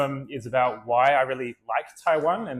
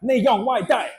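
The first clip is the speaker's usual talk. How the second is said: much higher, eager Mandarin question; enthusiastic shopkeeper; 9 semitones higher, 4 dB louder than usual. Retold or imitated. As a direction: chirp the order question. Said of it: "very enthusiastic".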